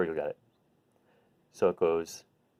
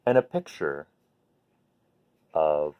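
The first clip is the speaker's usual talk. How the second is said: put-on reading to a child; 3 semitones higher than usual. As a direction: read it gently like tucking a child in. Parent reading bedtime book aloud.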